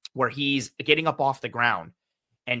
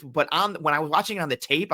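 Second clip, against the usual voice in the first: usual speaking voice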